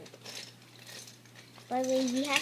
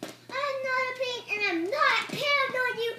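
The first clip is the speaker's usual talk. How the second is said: angry protest; 10 semitones higher, 5 dB louder than usual. Acted. Angry denial.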